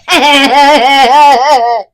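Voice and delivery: loud, long imitated group noise; mock boyish outburst. Host imitating the boys at Madam Hooch, loud mock outburst. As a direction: belt out the boys' reaction in one long burst. Mimics the boys' reaction noise.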